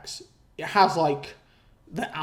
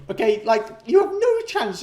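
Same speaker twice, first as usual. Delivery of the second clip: ordinary delivery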